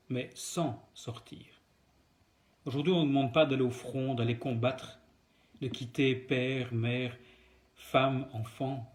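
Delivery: ordinary talk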